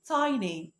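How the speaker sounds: tiny said as TY-nee with a long i, the correct way; correct pronunciation. Shown as a demonstration. A teacher shows students tiny the right way. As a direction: model tiny clearly with the long i sound. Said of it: "النطق الصح بتاعها".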